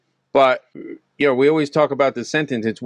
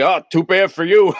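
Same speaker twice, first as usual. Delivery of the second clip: higher, louder flippant brush-off; mock gloating; 6 semitones higher, 5 dB louder than usual. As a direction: toss it off as a mocking taunt. Mock uncaring Adam, then laughs.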